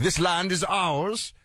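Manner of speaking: high, slow, put-on declaration; stretched-out, high-pitched mock proclamation; mock British invaders. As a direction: imitate colonists grandly claiming territory. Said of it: "the British just show up".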